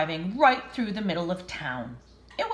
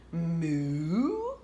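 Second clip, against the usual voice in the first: cow moo; 3 semitones lower than usual. Acted. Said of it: "said Cow".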